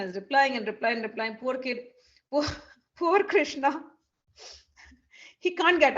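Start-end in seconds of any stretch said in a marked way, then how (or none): none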